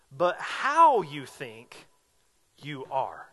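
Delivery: slow "but how you think", stop at the comma, drop on "you are"; pause before "you are". Shown as a demonstration. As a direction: set "you are" apart after a deliberate comma pause. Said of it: "There's a comma in there".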